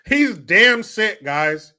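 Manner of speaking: loud, high, very slow parody; mock outrage. Host mocking the governor's outburst, loud drawn-out voice.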